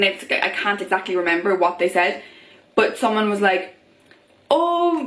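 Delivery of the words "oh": drawn-out, high flirty "oh"; mock-flirty, pitched well up. Someone flirting with a sober woman, mock flirty. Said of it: "flirty, like, casual tone".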